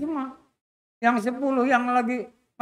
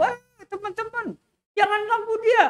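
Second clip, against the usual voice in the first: much higher shouted call; calling from afar; 13 semitones higher than usual. Acted. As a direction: yell to friends far ahead. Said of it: "Dia teriak-teriak dari jauh". (teriakan tinggi dari kejauhan)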